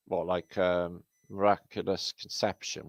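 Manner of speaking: usual speaking voice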